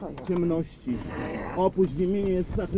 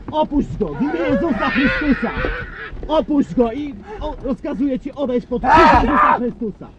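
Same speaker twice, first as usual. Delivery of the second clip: loud, forceful rebuke; commanding shout; 7 semitones higher, 7 dB louder than usual. Real, not acted. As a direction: cast it out at full force. Commanding the demon to leave.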